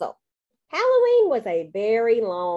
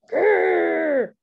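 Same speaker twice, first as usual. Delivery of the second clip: loud, higher drawn-out growl; scary clown growl; 6 semitones higher, 6 dB louder than usual. A narrator's reading. Kid clown trying to scare Cinderella, growling. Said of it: "I made a scary clown face".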